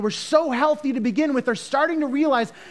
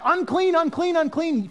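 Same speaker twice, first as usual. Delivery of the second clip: high-pitched mock yell, little extra volume; retold yelling; 8 semitones higher than usual. Preacher voices the leper's years of warning cries, mock yell. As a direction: call it out high, imitating the outcast. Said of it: "he was yelling out".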